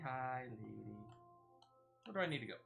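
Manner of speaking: ordinary talk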